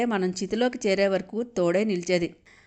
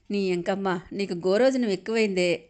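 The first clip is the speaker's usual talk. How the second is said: ordinary delivery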